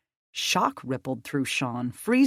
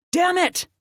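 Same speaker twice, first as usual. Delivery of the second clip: loud, much higher frustrated shout; sudden shout; 6 dB louder than usual. A narrator's reading. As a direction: burst out as they flee. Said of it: "Krista shouted suddenly".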